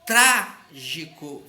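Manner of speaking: TRÁ-gi-co devagar, TRÁ bem alto; sílaba tônica destacada. Shown as a demonstration. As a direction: separe trágico e carregue no TRÁ. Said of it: "qual é a sílaba mais forte". (trágico silabado, TRÁ forte)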